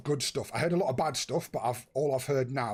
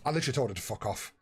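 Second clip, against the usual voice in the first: ordinary delivery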